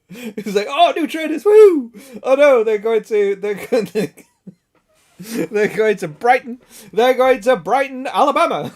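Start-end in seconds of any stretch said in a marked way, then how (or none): 0.7-1.9 very high, loud whoop; mock excitement. Imitating Pip delighted by new trainers, excited. 2.2-8.7 high, loud comic alarm; mock dismay. Acting out Pip finding trainers sent to Alabama, mock-dismayed. Acts out parcel going astray.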